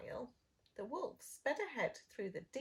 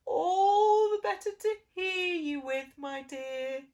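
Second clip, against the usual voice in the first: very loud booming wolf; wolf growl; 9 semitones higher, 14 dB louder than usual. Acted. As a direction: boom it out greedily. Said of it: "growled the wolf".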